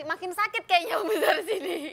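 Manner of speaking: pained laugh mid-sentence; laughing through pain. Real, not acted. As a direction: laugh while wincing from a sore gum. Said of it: "lumayan sakit juga sih".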